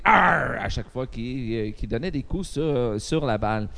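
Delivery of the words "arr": a short punchy pirate growl; pirate arr. Retold or imitated. Two guys on the court going arr with each hit. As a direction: growl it like a racquetball swing. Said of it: "en faisant des".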